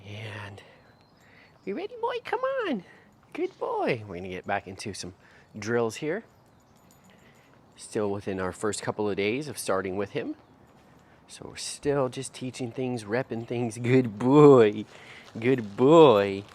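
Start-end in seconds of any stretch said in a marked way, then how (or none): 1.7-4.0 high, singsong call to the puppy; high dog-praise voice. Real, not acted. Trainer calling puppy in a high bright coaxing voice. 13.8-16.4 big loud praise for the pup. Real, not acted. Trainer praising puppy, loud enthusiastic good boy.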